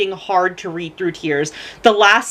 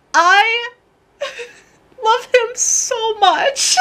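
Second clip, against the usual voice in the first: high, slow, tearful; sob-laugh the words out; laughing through tears; 13 semitones higher, much slower than usual. Real, not acted. Fan crying over a villain she loves. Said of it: "I cry".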